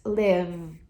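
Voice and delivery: Live with a voiced final v; model pronunciation. Shown as a demonstration. Teacher modelling the verb again. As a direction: end Live on a humming v. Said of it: "fazendo uso das cordas vocais".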